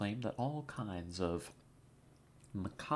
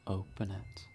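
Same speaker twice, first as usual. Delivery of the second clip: mysterious intro narration; 3 dB quieter than usual. Acted. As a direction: draw the listener in through the mist, half whispering. Dramatic whispered intro narration.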